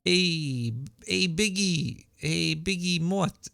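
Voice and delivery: slow, drawn-out East Coast "Biggie Mott"; East Coast accent. Shown as a demonstration. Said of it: "Biggie Mott brings out my East Coast accent".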